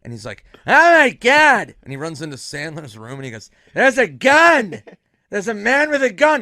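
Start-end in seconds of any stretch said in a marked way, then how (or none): 0.6-1.7 loud, high, drawn-out mock panic; Norm. Friend believing guy has a gun, mock-horrified shout. 3.7-6.4 high, loud, panicked alarm, imitated; Norm. Friend running into green room, mock-panicked shouting.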